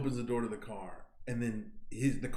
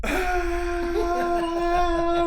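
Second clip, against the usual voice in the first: loud, high, drawn-out mock scream; panicked passengers' shriek, acted out; imitated scream; 9 dB louder than usual. Showing how everyone in the car screamed, loud mock scream.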